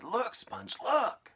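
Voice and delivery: very high, loud cry; shocked urging. Acted. Aunt urging her sister to look, high and loud. Aunt sees peach grow.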